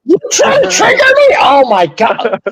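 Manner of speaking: high-pitched, loud indignation; riled up. Real, not acted. Host teased by co-host over his team, riled up. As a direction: squawk in disbelief at being provoked. Mock outrage at co-host's needling.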